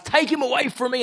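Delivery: loud, high angry command; angry order. Acted. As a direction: banish him in a rage. King orders arrest.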